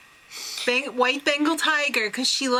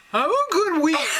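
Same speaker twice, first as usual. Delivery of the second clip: higher, hammed-up sign-off; imitated contestant; 4 semitones higher than usual. Dentist signing off his interview, hammed-up. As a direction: mimic an over-the-top goodbye. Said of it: "And he says".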